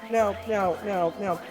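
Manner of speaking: ordinary talk, nothing marked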